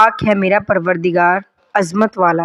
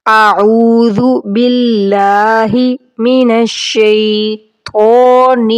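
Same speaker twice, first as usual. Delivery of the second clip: slow, drawn-out Arabic recitation; much slower than usual. Shown as a demonstration. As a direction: recite each word slowly and clearly. Teacher recites prayer slowly.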